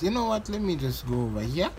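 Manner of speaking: ordinary talk, nothing marked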